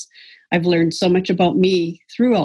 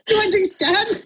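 much higher, laughter in the words; laughing question. Real, not acted. Wife seeking the group's sympathy, laughing. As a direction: ask it while still laughing. Laughing after her own punchline.

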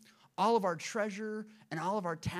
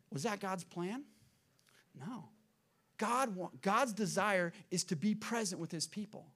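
ordinary talk, nothing marked

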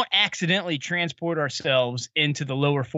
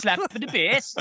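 high-pitched goofy movie-quote imitation; Paul Rudd; 7 semitones higher than usual. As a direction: quote him in a silly put-on accent. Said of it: "Paul Rudd, where he's like".

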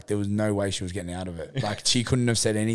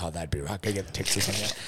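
soft, lower sarcastic mutter; muttered sarcasm; 3 semitones lower, 7 dB quieter than usual. Retold or imitated. In the gym, muttering over smudged cards, sarcastic. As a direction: grumble it under your breath. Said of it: "So I'm like".

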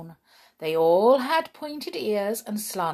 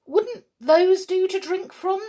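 higher, louder eager brownie voice; a small excited brownie; huffy little helper elf; 11 semitones higher, 6 dB louder than usual. Acted. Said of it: "said the brownie".

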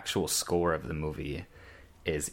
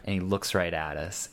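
normal talking voice

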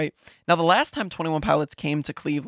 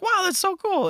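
mock excited lady. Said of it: "the lady at the counter is like".

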